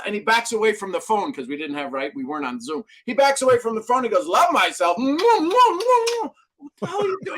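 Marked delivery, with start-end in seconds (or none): 4.2-7.4 much higher, hammed-up smacking kisses; playful kissing mentor. Mentor on the phone mocks him by kissing himself, much higher and playful. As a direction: ham it up blowing kisses at himself.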